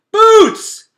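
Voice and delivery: loud desperate yell for his sister; frantic yell; hollering. Acted. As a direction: shout her name down into the dark. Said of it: "he hollered".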